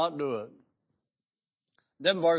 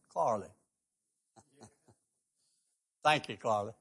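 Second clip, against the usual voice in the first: ordinary delivery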